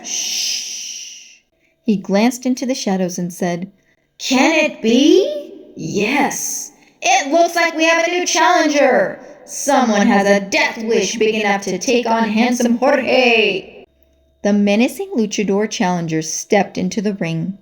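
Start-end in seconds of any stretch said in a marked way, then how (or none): 0.1-1.4 whispered shush to the crowd; announcer hushes the fans in a whisper; hushing shh. Acted. 4.2-13.9 higher, slow, dramatic arena reveal; announcer reveals a new challenger; ring announcer. Acted. Announcer voice held.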